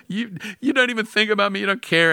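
high, hurt, tearful wife's protest; upset tearful wife. Retold or imitated. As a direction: squeak out her teary accusation. Crying wife quoted.

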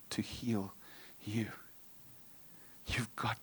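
soft whisper, urging; whispered plea. Real, not acted. Preacher urging listeners in a whisper. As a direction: breathe it to the congregation.

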